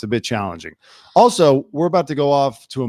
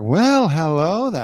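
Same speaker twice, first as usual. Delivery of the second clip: high, slow drawn-out greeting; hammed-up Southern belle hello; imitated Southern character; 6 semitones higher, 4 dB louder, slower than usual. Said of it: "when she came in like".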